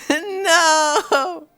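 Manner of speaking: high, loud burst of laughter; real laughter. Real, not acted. Streamer losing it after repeating the joke, high giggling. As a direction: laugh helplessly at the bit. Cracking up at own bit.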